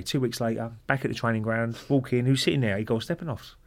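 normal talking voice

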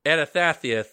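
"Anathathius" lisped with TH for S; every S turned TH in "Anathathius"; lisp on request. Shown as a demonstration. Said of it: "could you say Anathathius one more time".

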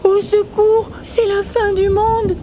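high-pitched frantic alarm cry; panicked little rabbit. Acted. Fleeing rabbit raises the alarm.

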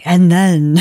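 mock creepy dramatic true crime narrator; exaggerated suspenseful storyteller voice; Keith Morrison. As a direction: ham up an ominous Dateline-style read. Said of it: "he didn't read it like".

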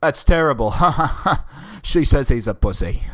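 mock protest broken by laughter; hammed-up chuckling mock scolding; laughing it off. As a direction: imitate fake disapproval, laughing through it. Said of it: "he's laughing about it the whole time".

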